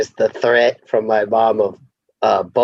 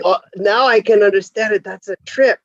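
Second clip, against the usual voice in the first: higher-pitched, teasing fake wonder; mock amazement; 7 semitones higher than usual. Parent teasing daughter about baby talk, mock amazement. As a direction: feign astonishment, ending in high baby babble.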